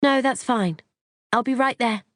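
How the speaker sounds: high, light reassurance on the phone; high-pitched courteous reply; polite phone voice. A narrator's reading.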